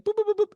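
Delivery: string of high-pitched boops; rapid high boops. Retold or imitated. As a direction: imitate a quick electronic-style "boop" run. Imitated sound effect in story.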